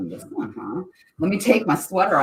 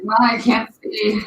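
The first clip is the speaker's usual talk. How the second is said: voicing the dog; 8 semitones higher, 8 dB louder, slower than usual. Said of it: "You're like".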